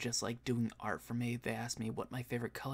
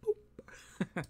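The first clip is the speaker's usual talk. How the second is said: cartoon boop; 7 semitones higher than usual. Sound effect after tagline.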